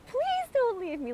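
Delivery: high, desperate pleading voice. Retold or imitated. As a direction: beg as if desperate. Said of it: "Like a, a pleading, a desperation".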